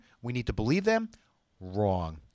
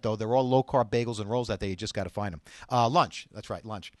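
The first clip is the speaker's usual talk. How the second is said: ordinary talk, nothing marked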